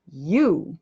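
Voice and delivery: YOU said loud and drawn out, blaming; accusing stress. Shown as a demonstration. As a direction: hit YOU hard, like pointing blame. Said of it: "I am blaming you, accusing you".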